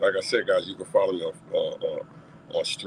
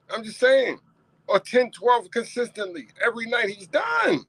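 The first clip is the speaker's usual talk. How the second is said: heated rant; 10 semitones higher than usual. Real, not acted. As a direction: rant like a fan who's fed up. Heated rant about the coach.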